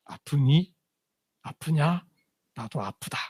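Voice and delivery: murmuring like sharing another's pain; quiet empathic murmur. Acted. Preacher voicing a hurting heart to the congregation, soft murmur. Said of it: "이렇게 되는 거죠".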